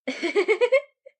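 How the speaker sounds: loud, high burst of real laughter. Real, not acted. Girlfriend laughing at her own coffee shop threat, loud and high. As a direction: cracks up at her own joke.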